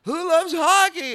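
high, loud stand-up opener; crowd-rousing shout. Retold or imitated. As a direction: imitate a host hyping a big theater. Retold shout to theater crowd.

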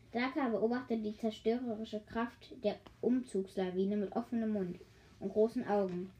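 usual speaking voice

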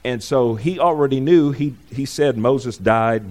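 normal talking voice

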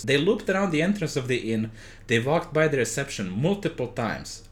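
usual speaking voice

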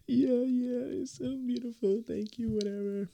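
very high teary mock-sob voice; imitating crying wife. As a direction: blubber thanks through mock tears. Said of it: "she was still crying".

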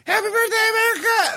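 mimicking a very high party shout; imitated falsetto holler. Retelling the friend's shout at jazz listeners, imitated falsetto holler. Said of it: "he'd be like".

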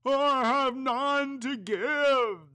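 high, slow ghostly answer; a mournful ghost replying. Acted. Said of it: "the ghost replied".

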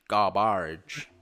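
exaggerated American "garbage"; mock American accent. Brit teasing the Americans, exaggerated American accent. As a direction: lean hard into an American pronunciation. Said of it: "You guys say garbage".

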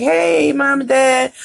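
high sing-song hello of a child acting innocent; innocent kid greeting. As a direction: greet the parents as if nothing happened. Kids feigning innocence to parents.

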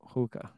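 "hookah" with an oo in place of oh; quiet "hookah" for Hoka; Boston-style hookah. Retold or imitated. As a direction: say "hookah" as HOO-kuh, softly. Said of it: "hear Boston people say".

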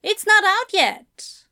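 slow, high, emphatic objection; startled objection. Acted. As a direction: point it out in a high character voice. Higher figure, echoed by Crowley.

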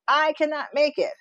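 high despairing wail; hammed-up wail of giving up; mock despairing wail. Spiralling self-talk quote.

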